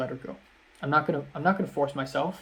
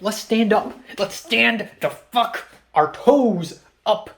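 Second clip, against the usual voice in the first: louder, higher, slow drawn-out call; rallying shout; 6 semitones higher, 6 dB louder, slower than usual. Real, not acted. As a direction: pump yourself up to stand. Rallying himself to stand up.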